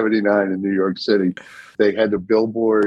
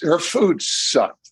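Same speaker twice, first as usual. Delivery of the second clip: slow emphatic jab; 6 semitones higher, much slower than usual. Real, not acted.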